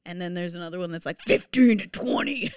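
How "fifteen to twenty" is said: higher, louder voice of a demanding rule; exaggerated demand. Quoting a grad school's page requirement, exaggerated demand. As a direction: blurt it as an outrageous demand.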